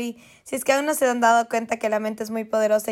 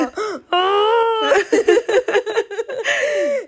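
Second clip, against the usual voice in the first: high, loud laugh that tips into a cry; real laughing scream, loud and high; laughing shriek; 11 semitones higher, 5 dB louder than usual. Real, not acted. Laughing about crying while cooking.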